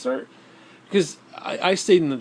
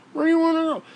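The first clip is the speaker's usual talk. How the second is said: high mocking question; mock Dale T; 13 semitones higher, faster than usual. Mocking buddy's question while driving, high squeaky voice. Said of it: "he's like".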